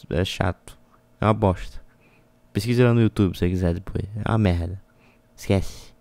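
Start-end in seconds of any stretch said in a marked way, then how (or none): none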